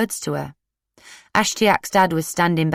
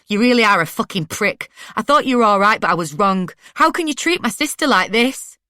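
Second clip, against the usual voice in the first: angry outburst; 5 semitones higher, 4 dB louder than usual. A narrator's reading. Sarah confronting Ashtiak angrily.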